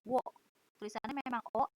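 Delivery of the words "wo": wo with a clear w onset; read as wo. Shown as a demonstration. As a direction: pronounce the particle O as wo. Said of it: "Ini O ini dibacanya wo ya".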